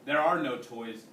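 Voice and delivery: usual speaking voice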